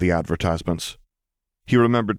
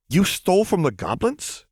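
bewildered question; 11 semitones higher than usual. A narrator's reading. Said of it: "he asked, looking at her bewildered".

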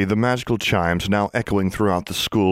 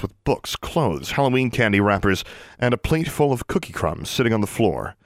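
ordinary delivery, nothing marked

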